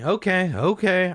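two high, loud okays; reassured okay. A narrator's reading. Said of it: "I said, starting to breathe normally".

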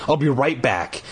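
usual speaking voice

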